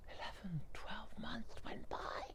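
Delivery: slow unvoiced whisper; hushed narration. A narrator's reading. Storyteller whispering the passing of a year. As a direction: let the time pass in a hush.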